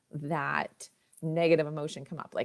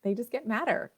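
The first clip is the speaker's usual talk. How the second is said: usual speaking voice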